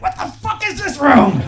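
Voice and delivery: be a dog that's surprised by a room; amazed dog. Pretend. Dog discovering the living room, amazed.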